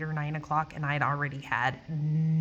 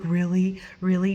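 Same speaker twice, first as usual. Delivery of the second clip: incredulous boss; 6 dB louder than usual. Retold or imitated.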